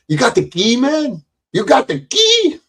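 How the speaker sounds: squeaky quoted movie line; movie quote voice. Retold or imitated.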